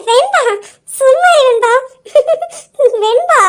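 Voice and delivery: high, drawn-out, bashful whine through giggles; giggling protest. Acted. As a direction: plead with your teasing friend, giggling, stretching the name. Teased girl giggles, begs friend to stop. (வெட்கச் சிரிப்புடன் சிணுங்கல்)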